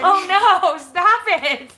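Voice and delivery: loud, high mock protest; playful protest. Real, not acted. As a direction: squeal it out, laughing. Yawn spreads, playful protest.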